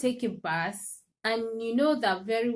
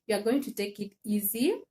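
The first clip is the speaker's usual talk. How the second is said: normal talking voice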